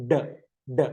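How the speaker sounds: short isolated "ഡ", not the letter name; letter D sound. Shown as a demonstration. Teacher answering which sound begins dog, short separate "ഡ". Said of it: "തുടക്കത്തിലുള്ള ശബ്ദം ഏതാ?".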